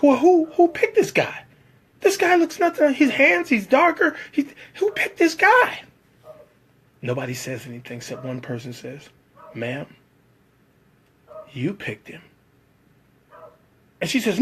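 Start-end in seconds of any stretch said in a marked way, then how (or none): 0.0-5.8 mock screaming director. Director screaming about who picked him, high and frantic. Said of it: "the director starts screaming". 9.5-12.2 much softer, hushed answer; timid reply. Retold or imitated. Crew member quietly telling the director, hushed and timid.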